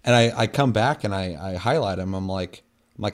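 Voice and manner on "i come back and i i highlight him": normal talking voice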